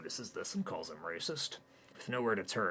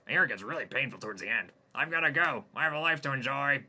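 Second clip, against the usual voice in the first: loud, fast, cruel gloat; gloating alien; 8 semitones higher, 9 dB louder, faster than usual. Acted. Jimmy mocks dying Hayden, loud and fast. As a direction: sneer and rush off.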